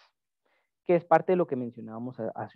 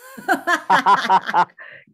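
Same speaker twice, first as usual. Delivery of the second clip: burst of laughter; 9 semitones higher, 8 dB louder than usual. Real, not acted. Student joked about being quiet.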